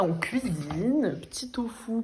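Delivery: ordinary delivery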